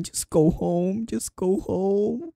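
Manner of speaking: high, slow whimpering plea; high, drawn-out whine; whiny plea. Real, not acted. Whiny pleading to creepy janitor.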